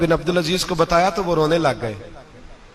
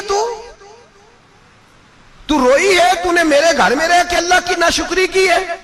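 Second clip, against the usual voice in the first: angry rebuke; 11 semitones higher than usual. Retold or imitated. Caliph scolding his crying wife, angry and raised. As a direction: rebuke her sharply for ingratitude. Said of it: "हजरत उमर जलाल में आ गए".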